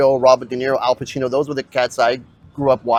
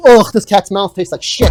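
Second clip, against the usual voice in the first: disgusted; 6 semitones higher, 7 dB louder than usual. Pretend. Pretend mouth to mouth on cat.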